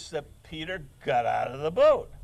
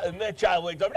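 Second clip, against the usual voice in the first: ordinary talk, nothing marked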